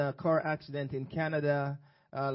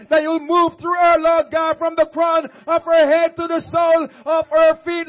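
louder, fervent preaching prayer; urgent prayer over the sick; 13 semitones higher, 17 dB louder than usual. Acted. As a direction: cry out to God at full force.

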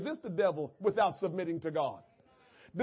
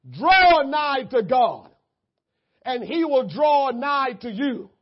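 loud, slow, stretched recital; preaching scripture; 2 semitones higher, 6 dB louder, slower than usual. Real, not acted. Preacher proclaiming James to the congregation, slow and loud. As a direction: proclaim each phrase.

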